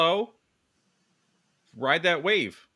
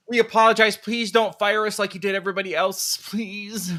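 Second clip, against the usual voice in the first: high, loud hammed-up apology; mock begging; 7 semitones higher, 3 dB louder than usual. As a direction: plead like a scared employee. Mock plea not to be fired.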